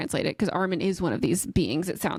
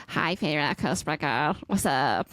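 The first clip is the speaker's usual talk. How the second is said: lower, teasing voice of a talking book; creepy book voice; 4 semitones lower than usual. Retold or imitated. Co-host voicing the whispering magic book, lower and sly. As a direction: play the sly book greeting her.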